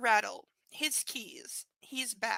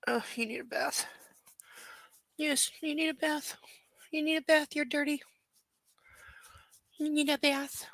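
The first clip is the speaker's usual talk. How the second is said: higher sing-song teasing of a dirty puppy; 4 semitones higher than usual. Real, not acted. Owner teasing her dirty dog, high sing-song. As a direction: playfully chide the pet in a lifted voice.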